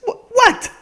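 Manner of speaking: squeaky shocked disbelief. Real, not acted. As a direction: blurt out in disbelief. Reacting to the letter.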